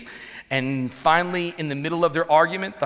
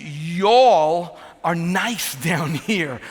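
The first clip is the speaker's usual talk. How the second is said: slow, louder attempt at a Southern drawl; 2 semitones higher, 5 dB louder, much slower than usual. Shown as a demonstration. Northerner complimenting Southern listeners, effortful Southern drawl. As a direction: stretch y'all out in a Southern drawl. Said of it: "I'm not used to the draw".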